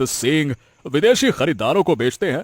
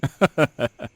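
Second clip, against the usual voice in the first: sly chuckle. Acted. Villain laughs at profit.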